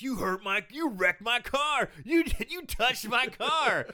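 high, slow mock-angry yelling; hammed-up jock indignation; outraged jock. Podcaster acting a jock over his wrecked car, mock outrage. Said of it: "in comes a jock who's like".

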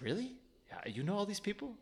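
high, soft imitated amazement; mock surprise. Retelling a stranger amazed at his reading, mock surprise. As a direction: ask it high and quietly incredulous.

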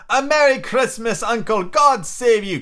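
high, loud and merry; cheerful cry. A narrator's reading. Cheerful nephew greeting his uncle for Christmas, cheerful cry. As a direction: call out a bright festive greeting. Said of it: "cried a cheerful voice".

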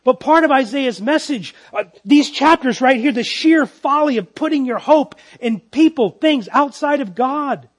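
fervent pulpit delivery; full-force preaching. Acted. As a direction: preach it out loud to the room.

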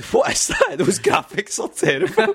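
high-pitched appalled outburst, laughing through it; appalled mum. Retold or imitated. Retelling his mum's verdict on the game, appalled. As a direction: imitate a mum aghast, pitch leaping up.

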